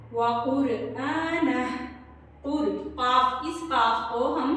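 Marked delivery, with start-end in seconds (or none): 0.1-1.6 कुरआना with a full heavy क़ from the throat; heavy qaf. Shown as a demonstration. Teacher shows the heavy qaf in कुरआना. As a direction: thicken the क़ of कुरआना. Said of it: "पुर पढ़ेंगे".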